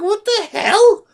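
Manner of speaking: mock outrage at a bizarre request; incredulous squeal. Retelling reaction to weird viewer.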